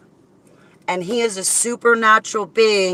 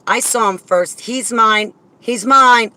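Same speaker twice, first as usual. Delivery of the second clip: mock-jealous, rising and louder; possessive; 4 semitones higher, 7 dB louder than usual. Telling viewers he's hers, mock-jealous. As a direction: claim him like a jealous child. Playful jealousy over God.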